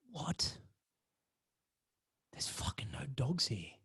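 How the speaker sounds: hushed, breathless whisper; scared whisper. Retold or imitated. The narrator whispers in fear, not wanting to be heard. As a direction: whisper it so nobody hears.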